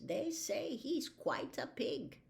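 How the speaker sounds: slow, musing doctor; an old doctor savouring the thought; lightly voiced old doctor.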